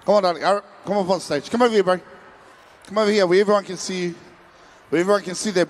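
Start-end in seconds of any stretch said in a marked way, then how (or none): none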